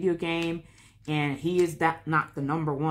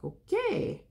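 sarcastic okay. Retold or imitated. Reacting to teacher's remark.

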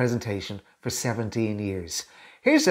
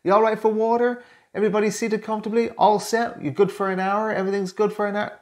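fast, much higher upbeat questions; cheery host check-in; 9 semitones higher than usual. Shown as a demonstration. Coach playing a presenter checking on his colleagues, bright and quick. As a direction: rattle off friendly questions to warm up the room. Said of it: "draw your colleagues in work in with a question".

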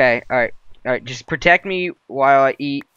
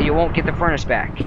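usual speaking voice

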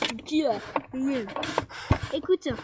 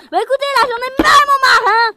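high, loud, crying-out yell; furious shout; 10 semitones higher, 10 dB louder than usual. Acted. Housemate yelling at the group that she's fed up. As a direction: scream that you've had enough.

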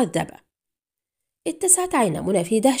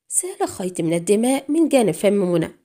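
plain reading voice

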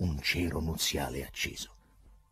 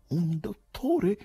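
slow, high-pitched query; onlooker's suggestion; 13 semitones higher, much slower than usual. A narrator's reading. Bystander wondering about the agitated poet, high query. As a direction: float it in a high voice. Someone in crowd suggests doctor.

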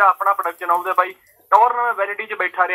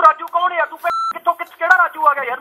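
furious yelling; 6 semitones higher than usual. Real, not acted. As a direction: shout down the stranger on the phone. Angry at prank caller.

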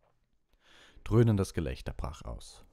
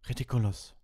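higher called-out spell; 7 semitones higher, 4 dB louder than usual. A narrator's reading. Seamus casts at the banshee, calling out. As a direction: call the spell out, moderately raised. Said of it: "rief Seamus".

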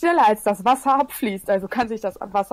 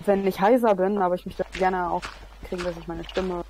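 usual speaking voice

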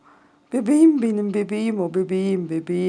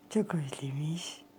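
whispered, much softer and lower; 7 semitones lower, 14 dB quieter than usual. Real, not acted. Owner whispering tenderly to her cat, missed her. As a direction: let it fade into a whisper.

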